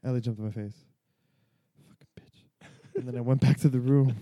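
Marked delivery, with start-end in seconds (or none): 1.9-3.0 slow whispered curse under the breath. Retold or imitated. Cursing the cat who jumped on his face, in a slow whisper. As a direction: hiss it slowly under your breath.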